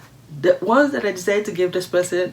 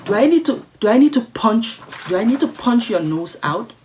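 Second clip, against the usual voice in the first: loud, raised-pitch challenge to a rude stranger; indignant outburst; 6 semitones higher, 6 dB louder than usual. Real, not acted. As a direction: flare up, restarting the threat louder and higher. Indignant reaction to insult read aloud.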